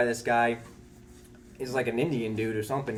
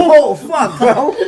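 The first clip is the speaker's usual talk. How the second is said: much higher and louder, laughing through it; laughing disbelief; 14 semitones higher, 18 dB louder, much slower than usual. Retold or imitated. Narrator realizes he got 911, shrieking with laughter. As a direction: burst out laughing at your own mistake.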